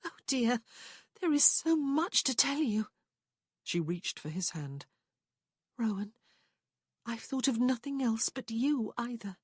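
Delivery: lightly voiced loving woman; Prue's voice. A narrator's reading. Prue confessing her love to Rowan, tenderly.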